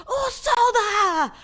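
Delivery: high, very loud drawn-out scream; full-blast imitation of a screamed accusation; mimicked anime scream. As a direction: scream it out long and shrill, imitating an anime girl. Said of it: "she screams".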